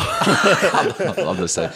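laughing through the words. Real, not acted. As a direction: giggle as you agree. Laughing agreement.

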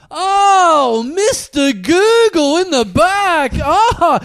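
high, loud, slow mocking voice; high slow mock Mister Google; mock driver voice. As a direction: ham up the driver squealing the name.

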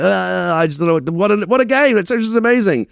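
high, gushing mock babble; flummoxed starstruck fanboy. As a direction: imitate a tongue-tied fan going squeaky with awe. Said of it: "you become that guy".

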